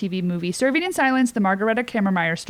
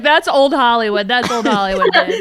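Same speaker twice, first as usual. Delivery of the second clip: put-on impersonated old-time actress; 3 semitones higher, 7 dB louder than usual. Older actress teasing a younger one on set, impersonated showbiz veteran. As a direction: play a brassy old Hollywood trouper. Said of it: "She's like".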